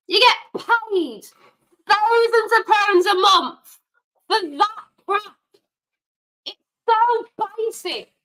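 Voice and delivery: high-pitched, slow, seething scold; furious fan berating a lazy midfielder; angry rant. Real, not acted. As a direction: spell out each word in disgust. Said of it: "Yes, I am getting angry".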